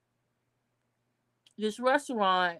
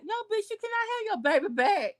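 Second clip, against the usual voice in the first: angry retort; 9 semitones higher than usual. Real, not acted. Reacting to a mother who abandoned her baby, indignant. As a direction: pitch jumps way up on a scornful no. Answering the girl's plea.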